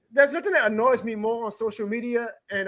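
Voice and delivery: ordinary talk, nothing marked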